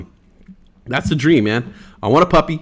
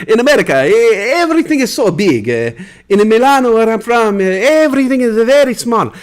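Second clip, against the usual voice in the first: put-on Italian accent; 3 semitones higher than usual. Streamer's alter ego tells chat about America, hammed-up Italian accent. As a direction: play the Italian from Milano marvelling at big America. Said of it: "by doing Giovanni Blanco".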